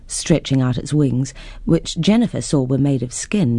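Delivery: plain reading voice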